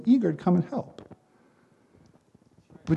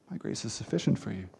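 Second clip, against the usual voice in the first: quoting God to Paul much softer and lower; hushed quote; 8 semitones lower, 12 dB quieter than usual. Shown as a demonstration. Preacher quoting God's words to Paul, softly and low. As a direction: let the verse drop to a low, soft hush, MY barely lifted. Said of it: "That's a huge statement".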